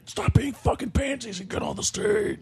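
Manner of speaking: mock metal screamer. Teasing the singer about yelling at fans, hammed-up metal scream. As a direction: ham up a heavy metal singer barking at the crowd. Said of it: "did you metal it up".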